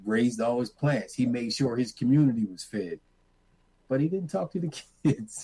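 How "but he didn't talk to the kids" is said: laughing. Real, not acted. Laughing about grandfather's silence.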